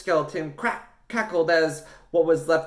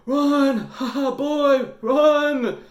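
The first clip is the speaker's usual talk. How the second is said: gasped laughing plea; 13 semitones higher, 6 dB louder, much slower than usual. Acted. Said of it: "gasped Dad".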